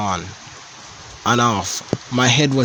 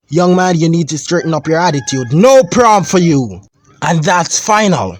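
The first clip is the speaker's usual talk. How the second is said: loud, high, slowing on the ban; father grounding his son from prom; stern father verdict; 6 semitones higher, 7 dB louder than usual. Acted. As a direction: lay down the law, final.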